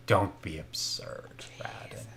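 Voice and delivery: slow, soft whisper; a quiet slow whisper; hushed. Acted. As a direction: whisper it dismissively. Strahd's dismissive hushed reply.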